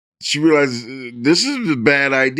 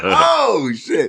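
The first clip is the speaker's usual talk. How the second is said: a high yelp of a surprised curse; mock startled curse; startled oh shit; 11 semitones higher than usual. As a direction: jolt up on the oh like you got caught out. Woman sees sheriff, startled.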